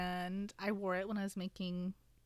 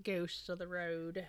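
cowboy accent; 4 semitones lower, 8 dB quieter, much slower than usual. Shown as a demonstration. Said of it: "like, a cowboy accent".

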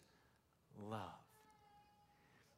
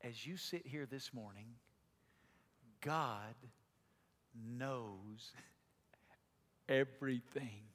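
hushed; 8 semitones lower, 7 dB quieter than usual. Acted. Soft address to congregation.

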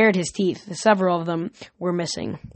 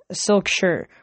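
usual speaking voice

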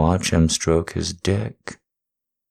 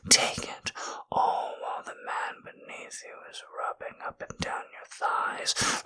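breathy close-mic ASMR whisper; intimate whisper; 6 semitones higher, 11 dB quieter than usual. Acted. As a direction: whisper right into the ear, unhurried.

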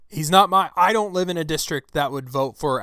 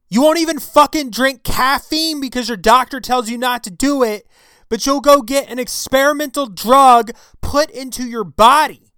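full-throated angry yell; screaming into the mic; 9 semitones higher, 11 dB louder than usual. Real, not acted. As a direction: blast it into the mic, furious. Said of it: "I'm screaming into the mic".

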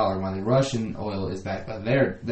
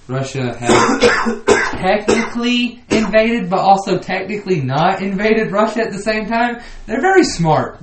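high, slow, loud ironic praise; mock praise; 8 semitones higher, 6 dB louder, slower than usual. Mocking Russia's trick to friend, high and drawn out. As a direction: ham up the admiration for the clever move.

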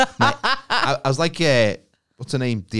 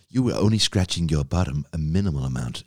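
lower, smooth gadget voice; a lightly voiced sycophantic robot; flattering device voice; 5 semitones lower than usual. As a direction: play a fawning smart assistant. Imagined flattering bedside tablet.

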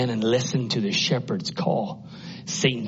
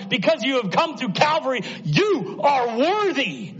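very high, impassioned pulpit cry; soaring, fervent preacher's voice; full-force preaching. Real, not acted. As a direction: proclaim it to the whole congregation, drawing out key words.

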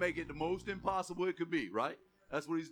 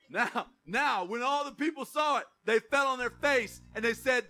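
preaching at full force; 4 semitones higher, 5 dB louder than usual. Acted. Pastor building up the fire story to the congregation. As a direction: preach it to the rafters.

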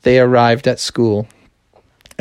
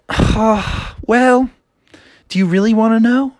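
higher, quick teasing voice; angsty princess; 8 semitones higher than usual. Acted. Frustrated princess teasing Froggy, higher quick voice. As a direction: tease them with the question. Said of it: "she was like".

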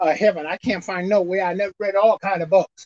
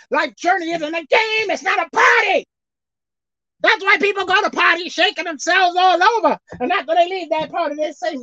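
fiery preaching; 10 semitones higher than usual. Real, not acted. Preacher to his congregation about life's journey, fiery crying preaching. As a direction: shout it to the church with a cry in the voice.

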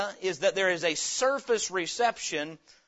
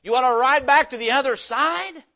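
mock holler; 7 semitones higher, 11 dB louder than usual. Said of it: "he hollers".

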